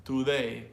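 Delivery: today said "too-DAY" with a full oo; Spanish-style pure vowel in the "to" of today; punched, overdone "too-day"; over-Hispanicized pronunciation. Shown as a demonstration. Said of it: "just to over Spanish, his Spanish decides that".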